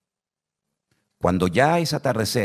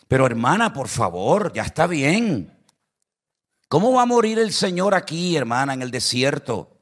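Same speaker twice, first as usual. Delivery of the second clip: exasperated; 6 semitones higher than usual. Retold or imitated. Pastor tells the sister he has had enough, exasperated and high. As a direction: throw up your hands, enough is enough.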